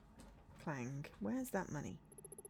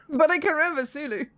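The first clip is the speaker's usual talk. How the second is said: laughing; 9 semitones higher, 15 dB louder than usual. Real, not acted. Laughing at forgetting actor's name.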